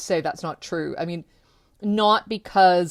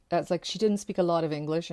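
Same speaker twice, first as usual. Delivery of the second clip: usual speaking voice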